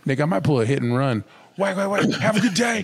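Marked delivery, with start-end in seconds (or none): 1.6-2.8 high cocky sign-off; jaunty parting shot; mock tough guy. Acted hit and run.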